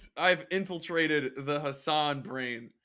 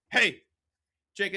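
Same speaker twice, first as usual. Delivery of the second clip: normal talking voice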